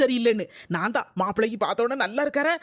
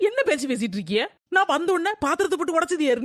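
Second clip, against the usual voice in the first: ordinary talk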